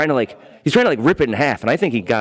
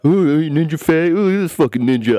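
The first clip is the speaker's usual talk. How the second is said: mocking taunt; 6 semitones higher than usual. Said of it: "talk shit under my breath".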